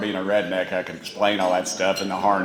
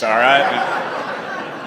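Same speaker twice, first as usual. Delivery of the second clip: normal talking voice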